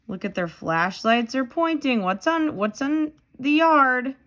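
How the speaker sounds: ordinary delivery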